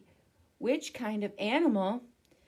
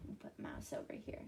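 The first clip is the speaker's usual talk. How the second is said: whisper; 17 dB quieter, much faster than usual. Real, not acted. Aside while placing picture.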